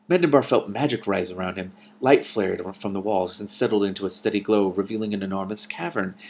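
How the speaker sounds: plain reading voice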